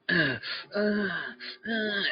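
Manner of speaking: high-pitched mock sex moaning; imitated sex moans. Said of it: "it really sounded like fucking".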